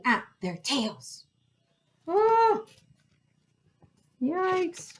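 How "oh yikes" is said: high "oh, yikes" of fright; alarmed yelp. A narrator's reading. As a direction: yelp at a near miss. Whale snapping at their tails.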